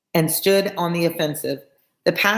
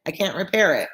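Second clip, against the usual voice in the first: plain reading voice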